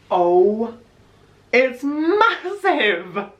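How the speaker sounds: loud, high, drawn-out amazement; amazed. Real, not acted. Vlogger holding the new phone, amazed high cry. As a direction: stretch it out high in astonishment.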